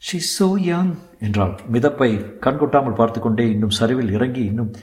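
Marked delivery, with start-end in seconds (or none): 0.1-0.9 higher, hushed-in-pity woman's voice; pitying young woman. Acted. Young woman looking at the drowned girl, pitying. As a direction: voice her shock at a death.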